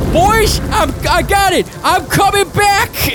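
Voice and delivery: very high-pitched, strained character cry; triumphant call, last words drawn out; exultant storm giant. Acted. Lindale returning as a giant storm, exultant high voice. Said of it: "He says".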